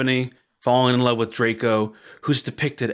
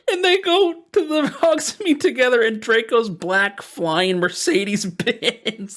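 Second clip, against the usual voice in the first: high, laughing through it; reading the plot while cracking up; 8 semitones higher than usual. Real, not acted. Host recounting the flying car, high laughing.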